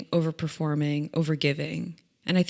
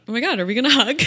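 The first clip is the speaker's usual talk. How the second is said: higher, louder gushing question; excited out-loud narrating; 5 semitones higher, 6 dB louder, much faster than usual. Retold or imitated. Client to stylist coming round the desk, excited blurting. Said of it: "So I say out loud".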